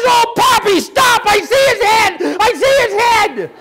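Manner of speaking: put-on high-pitched, urgent little-girl cry; excited granddaughter. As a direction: imitate a grandchild shrieking an urgent warning. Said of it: "She says".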